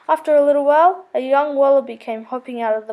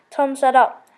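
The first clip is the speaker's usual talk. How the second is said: usual speaking voice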